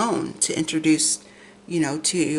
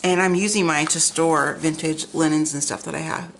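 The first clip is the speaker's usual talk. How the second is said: ordinary talk, nothing marked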